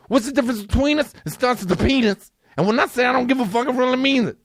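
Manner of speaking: high, fast, strained rant; escalating rant. Real, not acted. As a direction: push the rant over the top.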